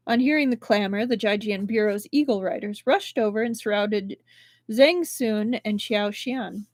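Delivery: plain reading voice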